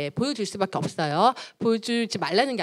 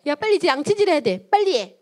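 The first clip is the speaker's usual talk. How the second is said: higher, louder impatient mock order; nagging parent; 6 semitones higher, 5 dB louder than usual. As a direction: hurry the child along crossly. Parent nagging child to brush teeth.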